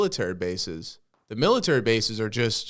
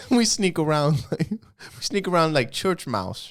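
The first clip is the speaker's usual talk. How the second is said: put-on Chinese accent; 4 semitones higher, slower than usual. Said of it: "We're not bad at Chinese accents".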